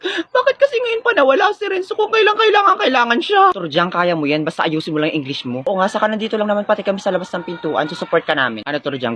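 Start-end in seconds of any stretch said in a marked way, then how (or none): 0.0-3.4 nerves climbing into a high wail; nervous panic. Acted. Contestant frets to friends before the quiz, high nervous whine. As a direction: start slow and nervous, rise to high-pitched fretting. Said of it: "I'm very nervous right now".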